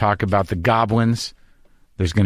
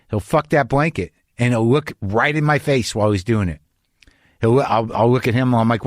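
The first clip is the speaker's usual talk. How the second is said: ordinary talk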